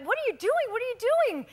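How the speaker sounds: high, repeated panicked yelling; high alarmed shouting; retold screaming. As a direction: yell it twice in alarm, high-pitched. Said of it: "sort of screaming".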